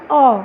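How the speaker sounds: অ said short and cut off; short vowel. Shown as a demonstration. Teacher showing students how little time it takes, short অ. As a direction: say অ as one brief beat. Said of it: "কম সময় লাগছে".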